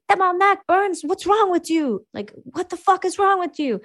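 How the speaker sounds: French-Canadian sergeant. Retold or imitated. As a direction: swear like an alarmed Québécois sergeant. Sergeant reacts to medicated soldier.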